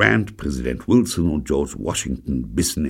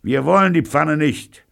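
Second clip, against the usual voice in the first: loud, higher assuring call; much higher calling reassurance; assuring shout; 13 semitones higher, 6 dB louder than usual. A narrator's reading. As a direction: promise it across the street. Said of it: "versicherte Pirolo".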